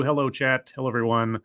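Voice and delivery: ordinary talk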